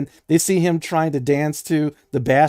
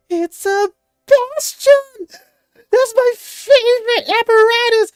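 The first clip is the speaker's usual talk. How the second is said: put-on very high, loud squealing child; excited young kid; 8 dB louder, slower than usual. Young cloven kid to his hero bastion, squealing with excitement. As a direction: stretch it out in awed, squeaky delight. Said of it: "his eyes get huge, and he is like".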